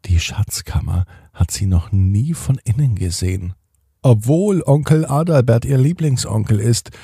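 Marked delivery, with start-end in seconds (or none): none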